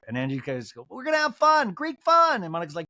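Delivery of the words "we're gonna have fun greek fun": very high, louder whoop; mock excited cheer. Castmate promising to fix the birthday, mock excited. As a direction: hype them up in a squealy high impression.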